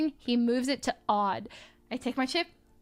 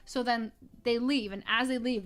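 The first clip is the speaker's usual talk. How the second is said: normal talking voice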